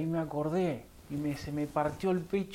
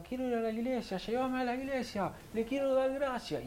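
much higher, imitated pleading voice; resounding inner voice; 9 semitones higher than usual. Inner voice resounds asking for church, imitated high. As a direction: imitate an insistent voice, pitched up. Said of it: "una voz que me resonaba en todo".

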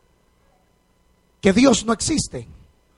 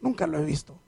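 put-on soft, timid child's answer, much quieter; shy and ashamed; 2 semitones lower, 9 dB quieter than usual. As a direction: mumble it, embarrassed, head down. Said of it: "se sonrojó y agachó la cara". (niño tímido y avergonzado, en voz baja)

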